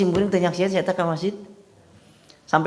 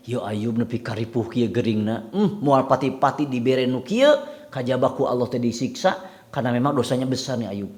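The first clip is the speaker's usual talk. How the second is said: mock gossip murmur; 5 semitones lower, 6 dB quieter than usual. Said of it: "Da bisik-bisikna teh tarik".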